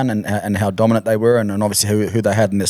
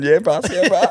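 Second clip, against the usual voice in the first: excited agreement; 10 semitones higher than usual. Retold or imitated. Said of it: "He's going".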